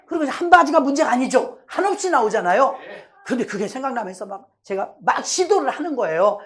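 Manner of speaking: ordinary talk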